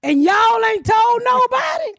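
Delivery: high, slow, shouted disbelief; hammed-up outrage; mock outraged shout. Demanding why police warned nobody, outraged shout.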